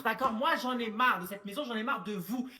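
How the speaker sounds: ordinary talk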